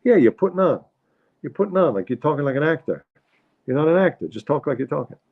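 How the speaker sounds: high-pitched, insistent husband impression; critical New York father. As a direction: keep scolding her in a New York accent. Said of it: "criticizing the mother".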